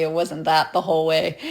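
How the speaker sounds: usual speaking voice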